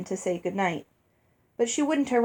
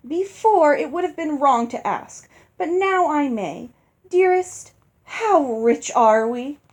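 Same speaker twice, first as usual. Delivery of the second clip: higher, eager wheedling wife; 6 semitones higher, 4 dB louder than usual. Acted. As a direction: lean in keen to hear the answer. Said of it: "turned eagerly to Father".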